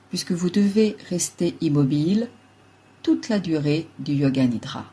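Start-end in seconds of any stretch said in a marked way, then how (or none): none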